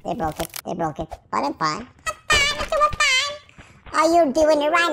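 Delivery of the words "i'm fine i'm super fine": very high put-on voice; squeaky reassurance. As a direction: squeak it way up high, drawn out at the end. Repeats it in squeaky voice.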